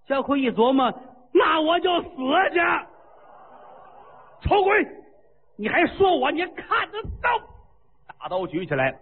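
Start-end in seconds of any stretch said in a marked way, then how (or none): 1.3-7.4 furious shout. Acted. Jiao Kui shouts in rage at the mocking queen, furious shout. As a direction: roar back at the one who insulted you.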